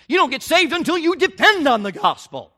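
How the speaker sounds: high and loud declaration; preaching at full force; fervent preaching. Real, not acted. Preacher climax on key point.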